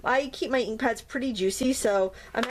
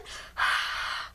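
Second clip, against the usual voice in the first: one long unvoiced breath pushed out onto the stamp; huffing warm breath; 5 dB quieter than usual. Shown as a demonstration. Crafter breathes on the inked cat stamp, a long breathy huff. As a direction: fog the stamp with a slow open huff. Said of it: "Now I'm gonna breathe on it again".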